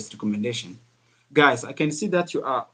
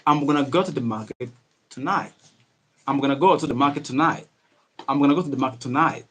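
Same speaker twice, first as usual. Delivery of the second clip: quick, run-together words with a reduced "gonna"; speedy English; faster than usual. Shown as a demonstration. Teacher shows how to speak faster, speedy linked words. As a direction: rattle it off in connected casual speech. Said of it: "speak a little bit, a little bit speed".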